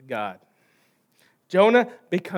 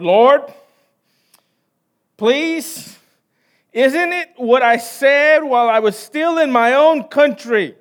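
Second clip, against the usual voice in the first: a pleading prophet, louder on Lord; Jonah pleading; 4 semitones higher, 6 dB louder, slower than usual. Acted. Preacher voicing Jonah begging the Lord. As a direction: beg God like a frustrated prophet.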